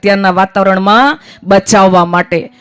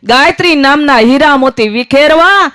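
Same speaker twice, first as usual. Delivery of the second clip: chanting like a pushcart seller; street hawker's cry; 4 semitones higher than usual. Acted. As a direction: cry your wares down the lanes. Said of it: "અમે પણ બૂમો પાડીએ".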